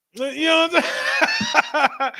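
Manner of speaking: high, slow laughing question. Real, not acted. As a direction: laugh through the words. Laughing at own joke.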